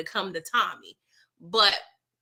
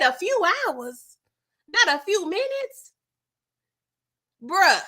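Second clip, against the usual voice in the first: incredulous; 8 semitones higher, slower than usual. Retold or imitated. Incredulous at Miguel.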